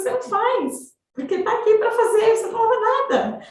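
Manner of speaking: high, insistent retold scolding; retold exploding. Mother scolds son over housework, exploding in a high voice. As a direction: re-enact a mother losing her temper, pitch up. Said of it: "Eu começava a explodir, eu gritava".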